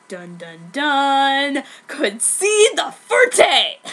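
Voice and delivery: dun dun dun like a soundtrack; dramatic. A narrator's reading. Dramatic sound effect and reveal.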